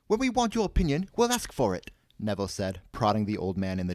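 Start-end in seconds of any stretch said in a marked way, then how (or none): 0.1-1.9 high, loud scolding voice; indignant rebuke. A narrator's reading. Neville rebukes the old man, indignant. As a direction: put him firmly in his place, high and loud.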